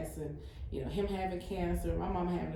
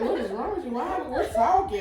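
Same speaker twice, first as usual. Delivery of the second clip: higher, laughing disbelief; baffled husband; 8 semitones higher, 7 dB louder than usual. Retold or imitated. A wife voicing her husband's puzzlement, laughing. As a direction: toss out his puzzled questions while laughing. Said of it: "He's like".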